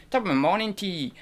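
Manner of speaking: high, faltering Irish accent. Shown as a demonstration. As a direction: try Irish and lose it midway. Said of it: "I can't do Irish".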